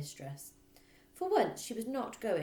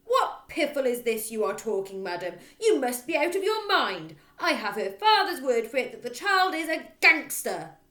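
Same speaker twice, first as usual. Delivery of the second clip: loud, higher raging; furious headmistress; 7 semitones higher, 12 dB louder than usual. Acted. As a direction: roar at her. Trunchbull raging.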